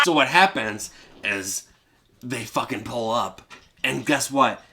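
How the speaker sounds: ordinary delivery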